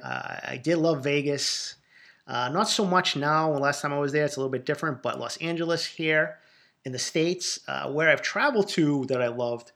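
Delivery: ordinary talk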